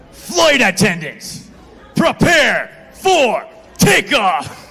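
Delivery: slow, loud, rising intercom yell; hammed-up frantic pilot announcement; alarming pilot. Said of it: "so far the opposite of that".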